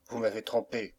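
ordinary delivery

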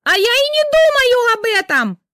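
piercing high shriek; wife shrieking at husband like a siren. Acted. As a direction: wail like a fire siren. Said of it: "верещала Рита, как пожарная сирена".

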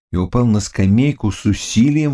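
ordinary delivery

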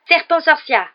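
high, loud spell cry; exclaimed incantation. A narrator's reading. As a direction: hurl the spell at your opponent. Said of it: "et s'exclama". (incantation criée, aiguë et forte)

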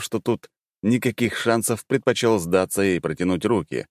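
plain reading voice

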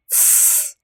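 sharp whispered "tss"; hushing. Acted. Pyera shushing Robert as he lies there, hushing. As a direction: hush him with a soft hissed shush. Hushing him during an intimate scene.

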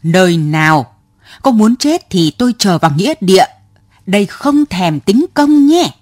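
rushed irritated retort, climbing in pitch; losing his temper. Acted. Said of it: "Ông nổi cáu".

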